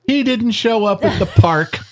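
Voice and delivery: lightly mocked high complaint; mock whiny daughter. Mocking the third daughter's complaint.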